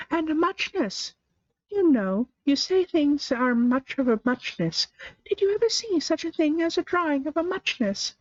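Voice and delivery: high, drowsy mouse voice; a sleepy little mouse rambling on, high; Dormouse. A narrator's reading. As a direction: Dormouse just woken, musing aloud.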